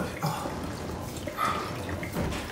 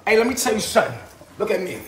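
ordinary talk, nothing marked

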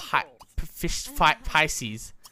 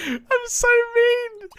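high, slow, cartoonish self-mockery; squeaky mock voice; slower than usual. Player mocking his own mean choice, squeaky and slow. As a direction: pipe it up high and drawn out like a cartoon. Mock line after scaring Mitzi.

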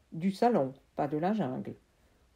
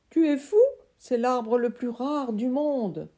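much higher, loud delighted voice; thrilled uncle; 5 semitones higher, 6 dB louder than usual. Acted. Uncle thrilled by his sprouting seeds, high and loud. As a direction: burst with delight.

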